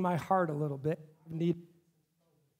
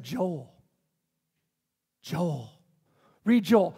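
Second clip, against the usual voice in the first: God's voice; 5 semitones lower, much slower than usual. Retold or imitated. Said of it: "He said".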